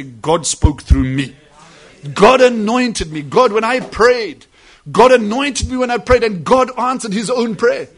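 loud, slow, and rising; preacher shouts the believer's testimony; fervent testimony preaching. Acted. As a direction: testify it with full force from the pulpit.

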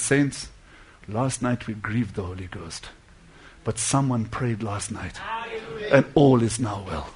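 low, hushed announcement; solemn pastor. Retold or imitated. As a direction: speak softly and gravely to the saints. Pastor solemnly addresses congregation, retold.

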